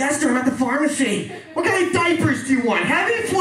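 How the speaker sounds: put-on old man talking too loudly on his phone; loud phone talker. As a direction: holler a question to the wife on the phone.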